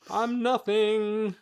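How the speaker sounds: high slow mock wail; mock despair. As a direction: wail it like a tragic nobody. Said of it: "you get the real me like".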